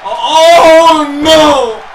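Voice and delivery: loud, drawn-out cry of dismay. Real, not acted. Commentator watching disaster in the ring, loudly dismayed. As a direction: groan it out at full volume.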